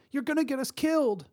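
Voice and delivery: very high, panicked pitch; frightened cry. A narrator's reading. Young mother to fellow hostage, panicked cry. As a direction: plead in fear for your life. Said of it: "the young mother behind her cried".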